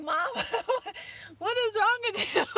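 high voice broken by laughter; voicing the dog. Speaking for the dog to crying owner, laughing. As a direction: laugh through tears as the puzzled dog. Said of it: "he's like".

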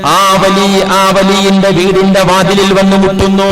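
loud, ringing sermon voice; preaching at full force. Acted. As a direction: proclaim the story to the hall. Preacher raises voice into story. (ഉച്ചത്തിലുള്ള പ്രസംഗ ശൈലി)

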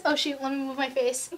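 ordinary delivery

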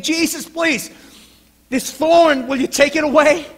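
loud, high plea; desperate pleading. Acted. Paul begging Jesus about the thorn, pleading. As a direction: beg urgently at full voice. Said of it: "he pleaded with God".

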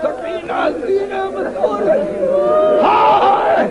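high-pitched, grief-stricken recitation; wailing majlis lament. A narrator's reading. Preacher tells of finding Sakina at the killing ground, wailing lament. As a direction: wail the phrases out to mourners.

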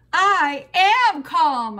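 slow, high, very loud holler; anything-but-calm shouted insistence. A narrator's reading. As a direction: holler it back, stretching each word. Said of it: "I hollered back".

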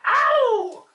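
high yelp. Acted. Entrance yell.

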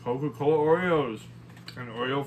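chewing while talking; mouth full. Real, not acted. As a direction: hand over chewing mouth. Seen 1: Bites cookie just before; hand covers chewing mouth mid-phrase.

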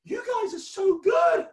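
high, loud, drawn-out cheer; excited praise. Real, not acted. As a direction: gush to the crowd at the top of your voice. Magician praises the cheering children.

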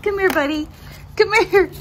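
high, loud pet call; coaxing the dog. Real, not acted. Owner coaxing dog to come back, high and loud. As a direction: call him sweetly but loudly.

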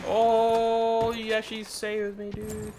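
high, delighted surprise; grateful surprise. Real, not acted. Streamer thanking a teammate who revived him, high surprised delight.

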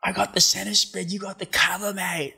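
put-on high, drunken Australian mate. Drunk Australian surfer bursting out of the bar, high Australian voice. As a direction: slur it like a drunk Aussie. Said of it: "this Australian surfer".

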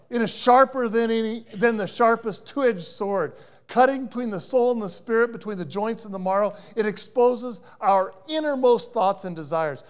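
loud, ringing scripture proclamation; preaching at full force. Acted. Preacher proclaims Hebrews to the congregation, loud and ringing. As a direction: declare every word to the congregation.

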